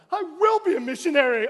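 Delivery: high, loud, hammed-up solemn vow; mock grand declaration. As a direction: ham it up like a movie convert. Mocking imagined dramatic conversion.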